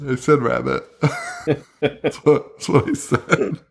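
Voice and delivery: soft, laughing protest; laughing defensively. Real, not acted. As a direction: giggle it out, half-defending yourself. Defending his word while laughing.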